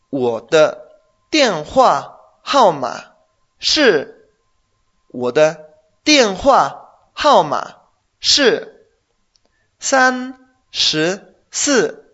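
broken into slow chunks with pauses between; one chunk at a time, loud and very slow for the learner. Shown as a demonstration. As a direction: drill it piece by piece, very slowly. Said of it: "Hazlo lento".

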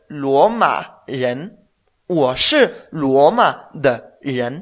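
teacher spells the answer out slowly; slow model phrase. Shown as a demonstration. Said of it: "Si yo digo".

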